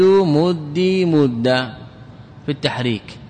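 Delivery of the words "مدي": مدي stretched very slowly, vowels held long; teacher stretching مدي for students; drawn-out demo. Shown as a demonstration. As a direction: spell out مدي slowly for the class. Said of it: "يجوز أن يقال".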